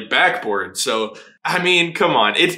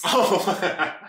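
ordinary delivery

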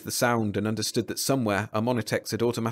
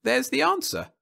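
high, pleased bureaucrat voice; smiling official; 12 semitones higher, 4 dB louder than usual. A narrator's reading. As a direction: smile through the words.

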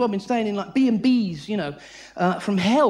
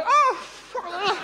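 higher, dismayed dog grumble; a let-down dog, pitched up; 8 semitones higher than usual. Retold or imitated. Dog seeing the ball thrown again, high comic dismay. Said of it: "they go".

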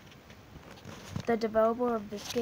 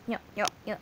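ordinary talk

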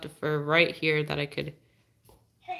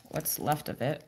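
usual speaking voice

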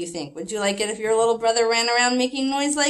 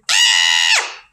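short loud pretend scream; play-scared shout for the kids; mock scream; 7 dB louder than usual. Teacher screams for the children, playful loud yelp. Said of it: "don't forget to scream".

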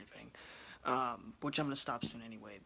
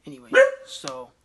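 flustered; 12 semitones higher, 25 dB louder than usual. Real, not acted. Still raised after yelling at dogs.

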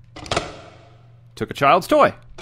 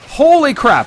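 higher, louder disbelief; stunned again; 8 semitones higher, 5 dB louder than usual. Real, not acted. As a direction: say it like you still can't believe it. Repeats stunned exclamation.

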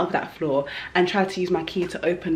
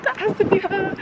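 much higher, laughing with excitement; excited; 13 semitones higher than usual. Real, not acted. Spotting her long-parked car at last, laughing excitedly. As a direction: squeal it out with a laugh.

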